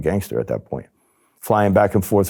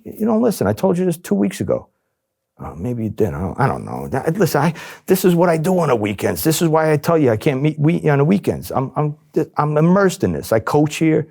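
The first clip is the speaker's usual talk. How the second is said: exasperated; 10 semitones higher than usual. Retold or imitated. Spinning an exasperated cover story.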